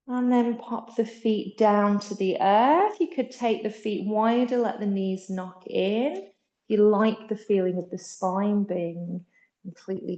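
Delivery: calm, gentle yoga teacher. Acted. Yoga teacher guiding Shavasana.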